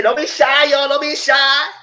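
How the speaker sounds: high, loud teasing call of don't be shy; squealing mock cheer at the kissing couple; hyped cheering. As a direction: egg them on like a hype crowd.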